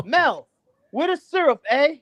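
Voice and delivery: light mock Canadian accent, rising on eh. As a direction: tease him with a hint of Canadian accent. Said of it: "they probably go around the house like".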